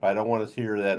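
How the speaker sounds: usual speaking voice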